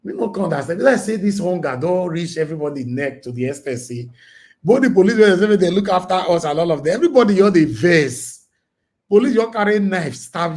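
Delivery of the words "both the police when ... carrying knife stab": louder, higher rising rant; exasperated outburst. Real, not acted. Ranting to viewers about a police stabbing, loud and indignant. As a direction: let the outrage boil over.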